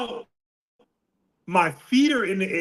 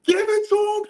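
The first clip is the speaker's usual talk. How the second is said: retold scream; 12 semitones higher than usual. Girlfriend screaming at the ring, high yell. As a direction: shriek it like a girlfriend cheering at ringside. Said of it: "she's screaming".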